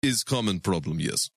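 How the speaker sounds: put-on low, matter-of-fact Russian-accented agent. Support agent reassures the caller, deep Russian accent. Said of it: "[name]'s Russian accent".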